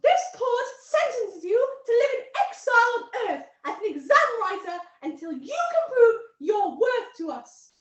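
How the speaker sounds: high, loud, pompous proclamation; booming courtroom decree, pitched high; alien judge passing sentence. Acted. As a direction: pronounce the sentence to a whole courtroom.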